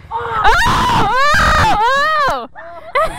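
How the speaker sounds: excited shouting. Real, not acted. Watching rider crash.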